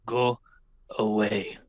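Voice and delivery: gruff dismissal. Acted. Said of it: "and he says".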